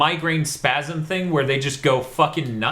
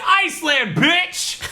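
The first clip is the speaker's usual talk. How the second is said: smug taunt; 10 semitones higher, slower than usual. Retold or imitated. Voicing character smugly.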